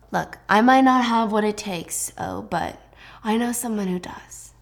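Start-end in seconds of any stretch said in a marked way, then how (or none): 3.2-4.5 hushed secret; whispering. Acted. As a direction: lean in and whisper the plan. Scheming sibling confides plan.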